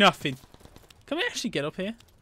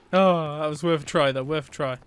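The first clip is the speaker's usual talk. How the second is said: ordinary delivery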